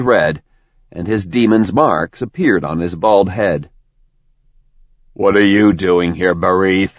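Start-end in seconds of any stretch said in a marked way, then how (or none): 5.1-6.9 a hint of cold menace; cold, lethal. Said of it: "in a cold, lethal tone".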